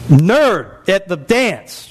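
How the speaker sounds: drawn-out punchline. Acted.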